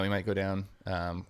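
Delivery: ordinary delivery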